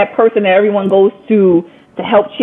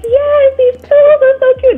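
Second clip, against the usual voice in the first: high, delighted squeal over cute pillows; thrilled mock-squeal at a cute find; excited gushing. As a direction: gush like you just found the perfect gift. Retelling finding the perfect gift.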